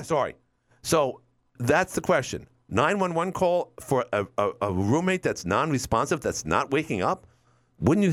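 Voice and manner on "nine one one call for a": usual speaking voice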